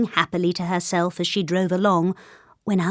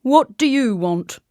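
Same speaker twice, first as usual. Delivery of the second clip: higher, put-on voice for a glum guard; gloomy soldier; 5 semitones higher, 4 dB louder than usual. A narrator's reading. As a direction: challenge a visitor as a gloomy soldier. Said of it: "he asked gloomily".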